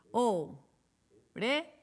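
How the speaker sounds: "Orejas" very slow, pausing after each syllable; three separate beats on "Orejas"; split into syllables. Shown as a demonstration. Teacher counting syllables for children, "Orejas" split slowly. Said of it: "¿Cuántas tiene?".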